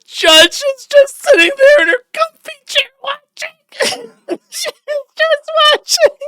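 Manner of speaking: high, squeaky laughter through the words; can barely get it out for laughing; laughing hard. Real, not acted. Streamer cracking up at the watching judge, real laughing fit.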